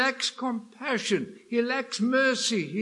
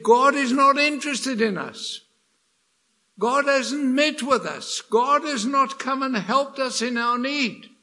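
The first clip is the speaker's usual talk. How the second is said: loud, higher, aggrieved protest against God; grumbling complaint; 5 semitones higher, 6 dB louder than usual. Retold or imitated. Israel complaining to God after forty years, loud aggrieved grumbling. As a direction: voice the people's bitter grumbling. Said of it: "they said".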